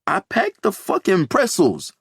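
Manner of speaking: whiny high complaint; complaining. A narrator's reading. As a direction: complain it defensively. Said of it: "Mateo complains".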